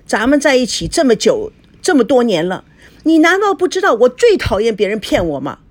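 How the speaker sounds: high, angry wife's outburst; furious yelling. A narrator's reading. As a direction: cry out in outrage at being lied to. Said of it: "孙娜愤怒地喊着". (愤怒地喊着)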